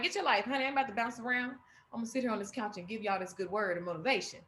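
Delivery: soft, peaceful motivation; peaceful calm voice. Retold or imitated. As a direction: settle in and soothe them. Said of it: "it's good and it's peaceful".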